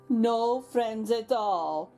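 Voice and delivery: ordinary delivery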